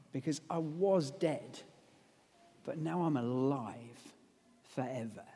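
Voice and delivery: low, soft, fading benediction; solemn blessing. Acted. Jesus giving his peace to the disciples, low and soft.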